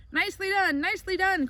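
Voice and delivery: a hammed-up goose cheering Wilbur. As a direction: cheer the pig on. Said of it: "cried the goose".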